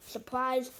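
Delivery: ordinary talk, nothing marked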